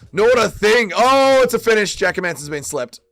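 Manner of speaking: loud, high shout at the finish; excited fight call. Real, not acted. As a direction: call the knockout as it happens, shouting. Calling a sudden knockout finish.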